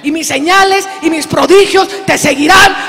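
shouting voice of God at full force; God's commanding shout. Acted. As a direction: thunder it out as God sending Moses. God sends Moses to Pharaoh.

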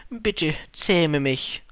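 slow, drawn-out plea; lingering, imploring request; pleading. Acted. Fox pleads after long silence.

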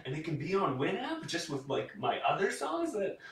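very high, squeaky excited voice; giddy disbelief. Retold or imitated. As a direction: squeal it like a thrilled teenager. Retold amazed reaction.

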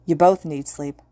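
usual speaking voice

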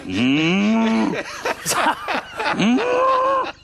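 high mock moo-like hums breaking into a laugh; mock cow grazing hums. Jokingly acting a cow grazing on lasagna, high laughing hums.

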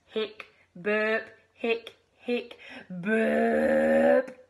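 mock hiccup and burp noises. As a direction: act out a chain of hiccups and burps. Frog hiccups and burps.

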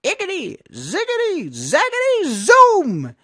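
very high, building to loud zoom; witch casting spell. A narrator's reading. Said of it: "she muttered a spell".

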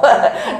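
short rising laugh; a quick amused laugh. Real, not acted. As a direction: burst into a light laugh. Laughs about Texas outbreak.

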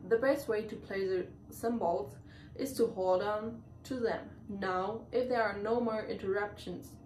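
ordinary delivery

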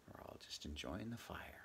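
soft breathy whisper, as if not to disturb the cat; whispered aside. Acted. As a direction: hush it, a private aside. Narrator's hushed aside by fire.

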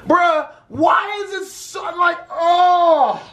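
high, drawn-out cry of disbelief; exasperated outcry. Real, not acted. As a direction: throw your hands up in disbelief. Exasperated outburst at the request.

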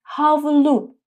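هاولو said in Turkish, hav-lu; Turkish word havlu. Shown as a demonstration. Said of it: "یعنی حوله". (هاولو با تلفظ ترکی)

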